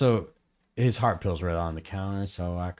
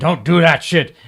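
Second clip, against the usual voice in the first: loud, raised scolding quote; higher, much louder mock warning; retold warning; 6 semitones higher, 13 dB louder than usual. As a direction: imitate him telling someone off. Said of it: "he was always say".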